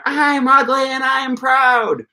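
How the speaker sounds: high, slow cartoon shout of pride; SpongeBob shouting. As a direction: belt it out like SpongeBob running around. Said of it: "he starts running around".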